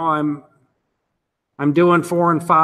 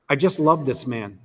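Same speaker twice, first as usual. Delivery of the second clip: usual speaking voice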